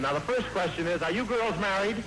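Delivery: ordinary talk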